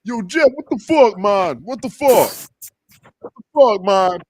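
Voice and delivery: high, loud mock outrage; imitating a friend cussing him out. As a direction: yell it like an offended buddy. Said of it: "He be like".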